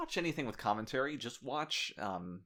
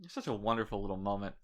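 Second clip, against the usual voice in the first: usual speaking voice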